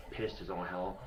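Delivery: ordinary talk, nothing marked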